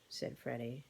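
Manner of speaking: usual speaking voice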